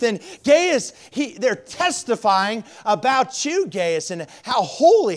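loud, high fervent preaching; preaching at full force. Acted. Pastor preaching loudly to his church, fervent. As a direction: proclaim it to the congregation.

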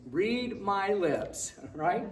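usual speaking voice

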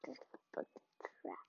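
soft unvoiced whisper. Real, not acted. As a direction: whisper it secretly. Whispering to viewers.

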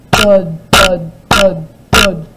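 each thud loud and heavy. A narrator's reading. Footsteps of the giant coming closer, loud and heavy. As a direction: stomp out every thud.

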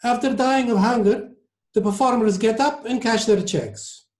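put-on high-pitched Italian-accented comeback. A narrator's reading. Maestro debunks opera romance for passenger, Italian accent. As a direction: make the point in an Italian accent. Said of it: "in his heavy Italian accent".

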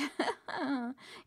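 lightly voiced, high laughing cackle; high mock cackle. A narrator's reading. Hidden voice mocking the lost traveller, high cackle. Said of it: "It laughed even louder".

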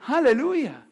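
lifted, ringing praise shout; preacher calling out to the church; joyful aleluya. Real, not acted. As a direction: cry it out in praise. Preacher exclaims to congregation.

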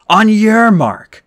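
loud, slow, drawn-out call; shouted. Acted. Percy shouts to Annabeth to lead, loud and slow. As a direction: shout it out, stretching the words. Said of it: "I shouted".

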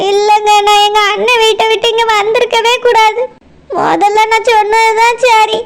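timid second wife explaining herself to her husband; meek second wife. Acted. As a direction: speak humbly, close to tears. Second wife voiced by same speaker.

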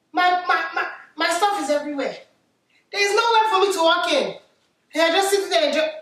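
scolding Nigerian mum voice; West African accent. Acted.

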